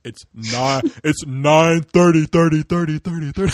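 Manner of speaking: slow, booming mock-ominous announcement. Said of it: "It was so ominous".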